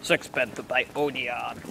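slow, low voiced ad tagline; perfume ad voice. Friend finishing a mock perfume advert, slow voiced tagline. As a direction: announce it like a fragrance brand.

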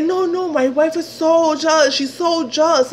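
high-pitched imitation of him; pleading excuse.

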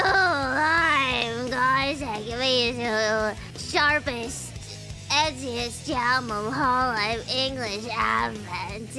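slow, low edgy drawl. Pretend. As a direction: act super edgy and cool. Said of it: "I am edgy".

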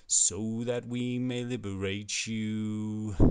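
very slow, drawn-out oratory; solemn, slow-motion pronouncement; solemn proclamation. Acted. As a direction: intone it as a grave manifesto, stretching the words.

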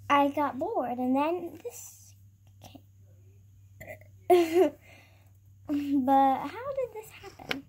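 usual speaking voice

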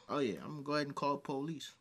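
low, quick undertone; quick, softly spoken aside; casual police-call threat. Acted. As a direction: act it out low, fast and offhand.

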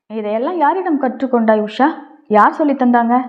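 ordinary delivery

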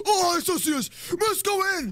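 very high, panicky cry; panicked squeal. Real, not acted. Spotted player urging teammate, panicked squeal. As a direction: squeal it in full alarm.

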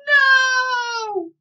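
high, loud fierce roar. A narrator's reading. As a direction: roar it in fury. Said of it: "roared Melinda fiercely".

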